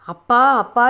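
tearful cry for her father, pitch a little up; a daughter sobbing for her father. A narrator's reading. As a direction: call out to Appa through sobs. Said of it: "விசும்பி அழுதாள்".

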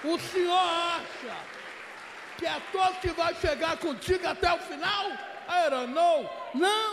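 fiery sermon yell; preaching shout. Real, not acted. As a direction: thunder it from the pulpit. Preacher erupts at the congregation.

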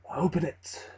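a quiet menacing order from the shadows; ominous dark figure. Acted. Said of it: "said the dark man".

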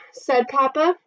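ordinary delivery, nothing marked

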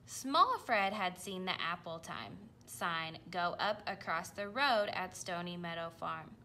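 usual speaking voice

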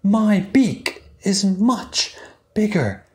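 slow, high, patient pelican. Acted. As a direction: explain kindly and slowly. Story voice for the pelican.

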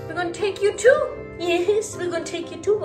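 high-pitched promise to an eager dog; pet talk. Real, not acted. Talking excitedly to pet dog.

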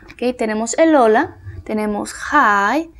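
ordinary delivery

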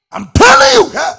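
screamed, very high and loud; shouted insistence. Real, not acted. Preacher screaming his point at the congregation. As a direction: hit it with everything. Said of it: "preacher insists at full force".

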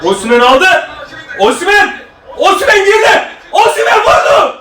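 rising, high, laughing shout; screaming football commentator. Real, not acted. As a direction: build like a goal call. Live play-by-play of the attack.

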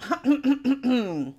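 a pointed throat clear. A narrator's reading. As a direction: clear the throat to call the class. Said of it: "cleared her throat".

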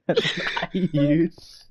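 very slow, high and laughing; helpless laugh stretching each word; laughing hard. Real, not acted. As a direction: drag the words out through real laughter. Cracking up repeating the line.

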